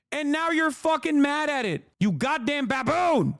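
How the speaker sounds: high-pitched exasperated scolding; angry insult. Real, not acted. Insulting the outraged viewers.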